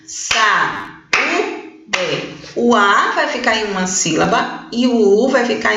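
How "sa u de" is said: "Sa-u-de" split into three drawn-out syllables with gaps. Shown as a demonstration. Teacher showing the class a hiatus, "Sa-u-de" syllable by syllable. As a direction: break "Sa-u-de" apart, a beat between syllables. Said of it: "Importante separar as sílabas".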